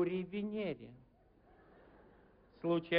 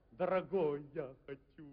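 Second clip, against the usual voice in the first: seductive ad voice; 3 semitones lower than usual. Pretend. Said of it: "рекламы просто возбуждают".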